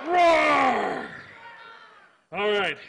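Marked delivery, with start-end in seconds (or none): 0.1-1.1 big shouted roar; dinosaur roar. Pretend. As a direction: bellow it out like a dinosaur. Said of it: "give me a roar".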